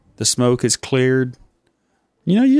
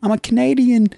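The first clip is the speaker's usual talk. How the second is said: much higher Canadian tough-guy voice; hammed-up Canadian; 9 semitones higher than usual. Canadian character taunting his interviewer, high and hammy. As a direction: puff up like a cartoon Canadian thug.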